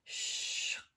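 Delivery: soft breathy latch noise; latch sound. Pretend. Lavatory latch slides shut, breathy click sound. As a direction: whisper it like a sliding latch.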